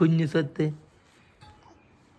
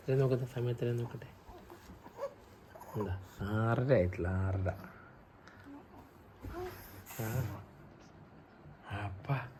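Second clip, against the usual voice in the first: murmuring to himself; 3 semitones lower, 12 dB quieter than usual. Real, not acted. Father checking time murmuring aside.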